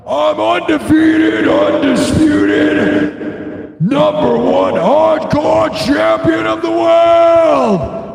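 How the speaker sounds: high, loud, stretched roaring promo; wrestling promo shout. Pretend. Boasting to the camera in a wrestling promo, roaring high and slow. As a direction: bellow it like a champ cutting a promo. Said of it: "my wrestling impersonation".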